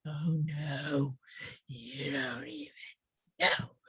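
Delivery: soft, whispered "you don't even know"; hushed aside. Retold or imitated. Speaker voicing her worried listeners in a whisper. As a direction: drop to a secret whisper. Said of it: "some of you are like".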